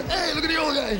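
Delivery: high delighted call-out; admiring call. Pretend. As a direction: sing it out like a fan spotting a cool old guy. Imagined admiration for old age.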